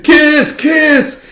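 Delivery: hammed-up smooch; mock kissing. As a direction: coo it playfully.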